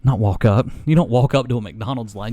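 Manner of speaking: loud correction. Real, not acted. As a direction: break the hush, correct yourself. Breaks whisper to correct himself.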